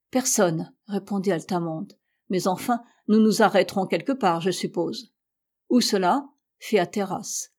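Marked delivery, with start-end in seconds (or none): none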